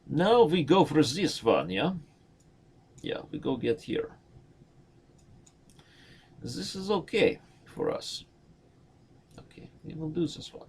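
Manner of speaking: playful put-on Russian accent. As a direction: ham up a Russian accent lightly. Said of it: "That is my Russian accent".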